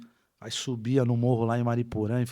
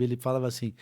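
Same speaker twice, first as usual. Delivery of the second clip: ordinary talk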